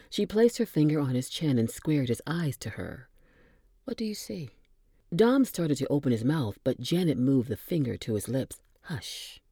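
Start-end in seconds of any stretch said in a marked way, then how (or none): 3.8-4.5 hushed, intimate question; whisper. Acted. Janet asking Dom in a close whisper. Janet holding Dom's gaze. 8.8-9.4 breathy hush; whisper. Acted. Janet silencing Dom in a whisper. Finger on Dom's lips.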